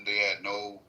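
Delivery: ordinary talk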